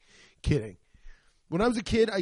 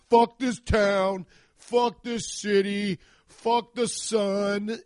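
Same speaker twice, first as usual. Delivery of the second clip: slow, louder, higher mock rant; hammed-up summer-hater cursing the heat; 5 semitones higher, 5 dB louder, much slower than usual. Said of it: "And I'm gonna be like".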